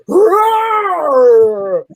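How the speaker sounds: loud, high roaring blast; explosion roar. Retold or imitated. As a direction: roar out like a fireball going off. Flash pod exploding.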